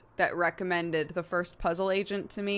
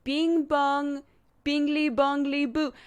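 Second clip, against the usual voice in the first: put-on high French-accented recital of nonsense words; 8 semitones higher, 4 dB louder, slower than usual. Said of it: "Dodger's French accent is really good".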